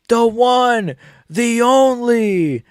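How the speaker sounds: drawn-out ring announcer call; mock announcer. Fan imitating the announcer's intro for Alex, hammed-up. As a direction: stretch it like an arena announcer.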